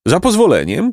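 high-pitched, polite interruption; interrupting donkey. A narrator's reading. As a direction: cut in with pompous courtesy. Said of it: "przerwał Osioł".

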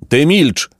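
shouted. A narrator's reading. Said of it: "krzyknął zbójca".

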